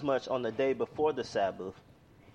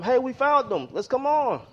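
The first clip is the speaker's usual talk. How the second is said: higher, louder excited shout; excited call; 10 semitones higher, 6 dB louder than usual. Acted. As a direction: shout that you've spotted him. Plane crew spotting the man.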